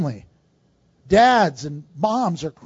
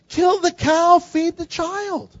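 high-pitched exasperated retort, slowing at the end; exasperated plea; 7 semitones higher, slower than usual. Retold or imitated. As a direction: plead the obvious, pitched up and stretched out. Preacher's exasperated retort to starvation pictures.

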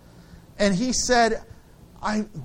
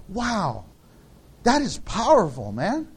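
ordinary talk, nothing marked

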